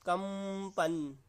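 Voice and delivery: कंपन stretched very slowly so each sound vibrates; drawn-out demo. Shown as a demonstration. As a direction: slowly draw out कंपन, letting the nasal hum. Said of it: "देख सकते हैं कैसे आवाज़ आ रही है". (कंपन को बहुत धीरे खींचकर बोलना)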